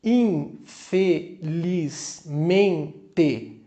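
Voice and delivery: infelizmente and comunicado in very slow, separate beats; syllable by syllable. Shown as a demonstration. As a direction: count out infelizmente, comunicado with a pause after each syllable. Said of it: "quatro sílabas ou mais".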